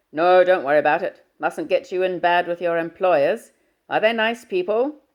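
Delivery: booming fatherly hello down the line. Acted.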